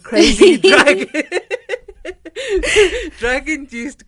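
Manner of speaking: breaks into loud laughter on a high pitch; can't finish the phrase for laughing; giggling at the absurdity, real laughter; laughing mid-word. Real, not acted. Laughing while describing Ben Johnson.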